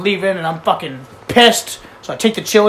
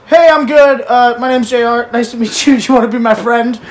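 high, eager, louder; overeager customer voice; 5 semitones higher, 5 dB louder than usual. Retold or imitated. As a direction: gush like a goofy customer. His overeager joke reply.

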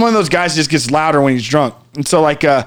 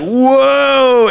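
much higher, long whoa; awed whoa; 8 semitones higher, 3 dB louder than usual. Retold or imitated. Stars sticking to his body, awed. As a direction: let the whoa float up.

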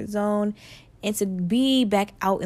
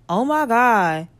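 wowed reaction; 2 semitones higher, slower than usual. Shown as a demonstration. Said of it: "reaction after every single one of my episodes".

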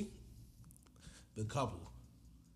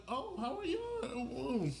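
mock friendly greeting; 13 semitones higher, much slower than usual. Retelling cruise couple greeting.

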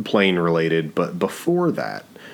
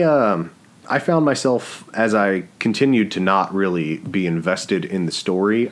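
ordinary delivery

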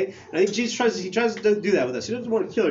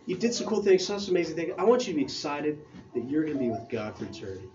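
ordinary delivery

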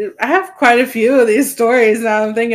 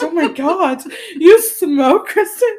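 higher, laughing imitation of shock; mock shock; 7 semitones higher than usual. Imitating sister shocked that she smokes, mock shock. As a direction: tease her with a scandalized reaction. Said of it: "you're shocked".

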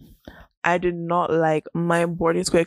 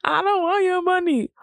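mock protest; 12 semitones higher than usual. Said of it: "I'm like".

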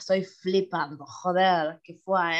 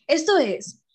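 slow, drawn-out announcer voice, louder and higher; announcer intro; 5 semitones higher, 5 dB louder, slower than usual. Acted. Host announcing the podcast title, slow and loud. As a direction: announce the show name like a presenter.

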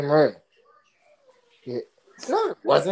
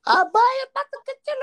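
imitating the baby's gibberish; 4 dB louder, faster than usual.

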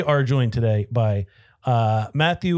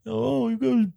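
mock quote; 7 semitones higher than usual. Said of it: "we've been told".

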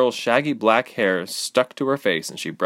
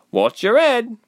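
high, loud warning with a touch of effort; labored breaths; 6 dB louder than usual. A narrator's reading. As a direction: warn as if lightly winded. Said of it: "between labored breaths".

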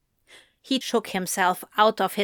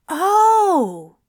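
high, louder "oh" of discovery; sudden realization; 10 semitones higher, 7 dB louder than usual. A narrator's reading. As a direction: it just clicked.

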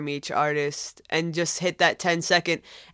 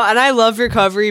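ordinary talk

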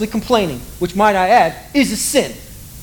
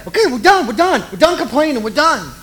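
higher, laughing hurried surrender; mock surrender; 7 semitones higher than usual. Israelites begging God to stop, high laughing surrender. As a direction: beg off, pitched up and half laughing.

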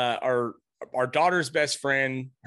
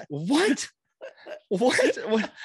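retold surprise; 7 semitones higher, much slower than usual. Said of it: "I'm like".

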